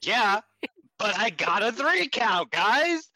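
high, loud, whiny brag; whiny Mayu mockery. Podcaster mimics Mayu bragging about her win, high whine. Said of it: "she created that with".

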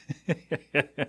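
rapid chuckle mid-sentence. Real, not acted. Podcaster amused at his own lost free time, chuckling. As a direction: break off into a quick chuckle.

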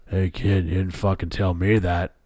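lightly voiced grumpy costar; a hint of a miffed older actor; Harrison Ford. Harrison at the premiere, mildly miffed at Mark. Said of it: "Harrison Ford impression".

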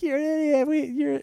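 high-pitched jumbled heckling; squeaky mock insults tumbling out; mock yelling. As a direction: imitate a crowd jeering in falsetto. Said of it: "yelling at me".

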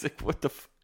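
ordinary delivery

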